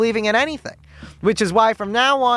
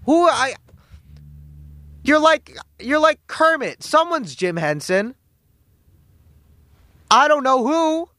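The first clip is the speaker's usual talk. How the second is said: heated mockery; 7 semitones higher than usual. Host ranting at the CEO about Kermit, high heated mockery. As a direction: rant at him, climbing up.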